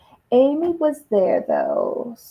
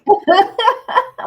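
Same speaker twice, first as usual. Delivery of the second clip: high, loud burst of laughter; bright laugh; 10 semitones higher, 6 dB louder than usual. Real, not acted. Host cracking up over the Malta remark, loud laugh. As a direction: laugh out at the joke.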